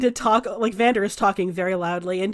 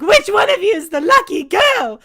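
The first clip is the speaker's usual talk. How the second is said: higher, louder call to the whole room; excited shout; 8 semitones higher, 7 dB louder than usual. Acted. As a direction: bellow the question to everyone. Vander calls to the room.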